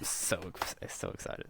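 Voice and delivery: hushed, whispered excitement; mock-excited whisper. Co-host hamming up excitement for the new movie, whispered.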